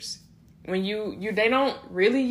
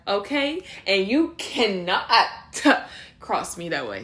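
louder, sassy grown woman telling someone off; grown woman putting someone in place; 3 semitones higher, 4 dB louder than usual. Acted. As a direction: read someone who crossed you, now a grown woman. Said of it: "I'm changing my voice like that".

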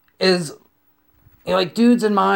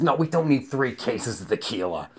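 lower, softer put-on voice; date's soft voice; 6 semitones lower, 7 dB quieter than usual. Date refusing extra tequila, low soft voice. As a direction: object quietly as the date.

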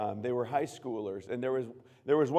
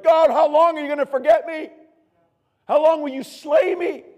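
high, loud anguished cry; hammed-up cry to God, much louder; psalmist's lament cry; 14 dB louder than usual. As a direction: wail it like David in the Psalms. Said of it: "David was like".

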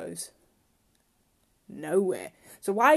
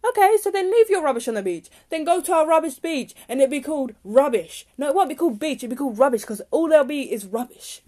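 higher and louder, real exasperation; venting fury at litterers; angry rant; 7 semitones higher, 6 dB louder than usual. Real, not acted. Ranting about rubbish on the beach, really angry. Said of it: "I know why I'm getting so angry".

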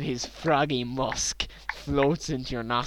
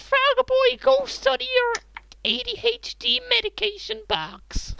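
high mom voice. Pretend. Mom scolding Frog Boy.

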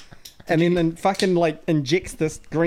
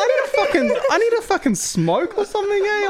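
much higher, laughing through the quote; laughing retelling; 4 dB louder than usual. Retold or imitated. Patient retelling his reaction, laughing. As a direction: crack up while quoting yourself. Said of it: "I'm like".

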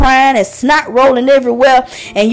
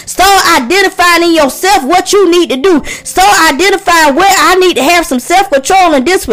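full-force preaching; 4 semitones higher than usual. Acted. Pastor urging listeners to surrender their pain to God, preaching hard. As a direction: preach it out, lifting the congregation.

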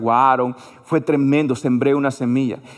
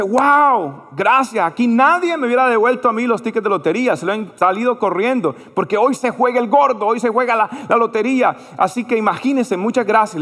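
imitating an excited, grateful lottery seller, pitched high; retold burst of surprised thanks; amazed gratitude; 7 semitones higher than usual. Lottery seller thanks the man who returned his tickets, amazed.

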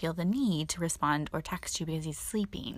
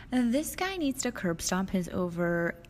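ordinary talk

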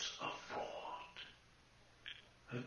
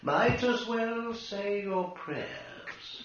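higher, louder, slow sneering taunt; gloating taunt; 11 semitones higher, 7 dB louder, slower than usual. Acted. Ghost mocking trapped Todd, gloating. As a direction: drag it out to mock him.

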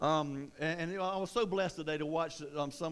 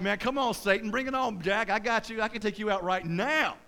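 louder, cocky dare; fired-up challenge; 3 semitones higher, 5 dB louder than usual. Acted. Believer daring Satan on a good day, fired-up bravado. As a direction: take on all comers with mock bravado. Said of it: "One day you're like".

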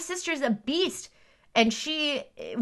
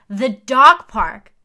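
loud and slowed right down, leaning on dog; big slow proclamation of the answer; loud, drawn-out reveal; emphatic announcement; 9 dB louder, much slower than usual. Acted. Emphatic list punchline.

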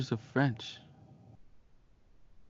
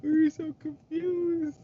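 very high, drawn-out squeal through laughter; laughing hard; slower than usual. Real, not acted. Podcaster recalling baffled classmates to co-host, squealing with laughter. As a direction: squeak it out slowly while cracking up.

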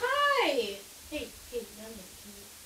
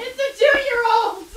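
shocked exclamation; 8 semitones higher, 12 dB louder, slower than usual. Acted. Shocked outburst at dinner table.

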